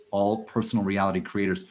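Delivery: normal talking voice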